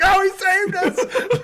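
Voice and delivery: very high, slow, laughing joy; mock celebration. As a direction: squeal it like the kids just won. Kids celebrating in retold scene.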